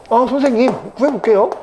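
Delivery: high-pitched student answering the teacher; eager student. Acted. (높은 목소리로 대답하는 학생 흉내)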